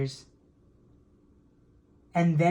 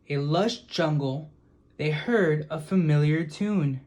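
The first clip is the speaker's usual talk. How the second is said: usual speaking voice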